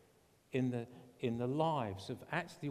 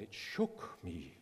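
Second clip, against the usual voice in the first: low, slow imitated German voice; German accent; 7 semitones lower, 3 dB quieter, slower than usual. Missionary recounting God's word, low slow German accent. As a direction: draw it out in a mock German accent. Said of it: "in the same German voice".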